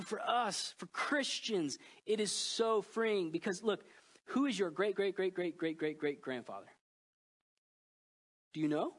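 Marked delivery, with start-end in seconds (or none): none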